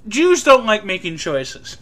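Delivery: ordinary talk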